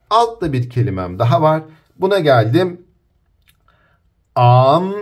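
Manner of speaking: ordinary delivery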